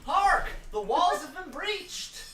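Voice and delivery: high, loud epic storyteller voice; dramatic recap narration. Acted. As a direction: proclaim it like a fantasy herald. Game master dramatic recap narration.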